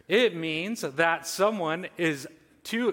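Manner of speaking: ordinary talk, nothing marked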